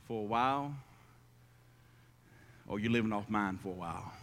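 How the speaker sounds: quiet pulpit reflection; hushed preaching. Acted. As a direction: draw the congregation in close.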